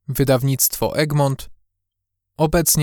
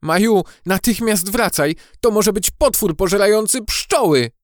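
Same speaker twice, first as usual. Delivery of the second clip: high, urgent character voice; alarmed warning; 8 semitones higher, 4 dB louder than usual. A narrator's reading. Gucio scares Maja back from the bottle, alarmed. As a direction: warn your friend away from danger. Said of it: "postraszył ją Gucio".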